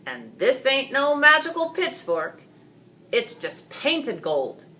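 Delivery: an angry father debunking the magic pitchfork; scornful accusation. Acted. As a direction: sneer at the fake weapon. Angry father exposing fake pitchfork.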